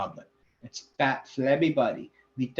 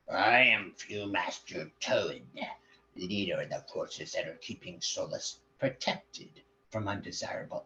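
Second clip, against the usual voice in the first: pompous hobgoblin leader; 3 semitones lower than usual. Acted. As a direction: announce the arrest with grand menace.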